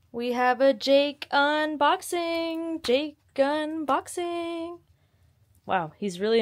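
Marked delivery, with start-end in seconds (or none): 0.1-2.8 high, slow announcement; drawn-out high presenter call; announcer-ish sing-song. Acted. Announcing dog's unboxing. 2.8-4.8 higher, slow stretched call; drawn-out high chant; sing-song title. Acted. Repeats title higher, slower.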